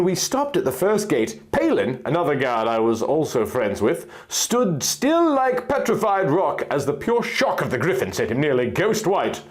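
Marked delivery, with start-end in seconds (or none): none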